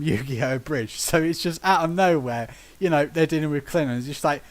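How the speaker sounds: ordinary talk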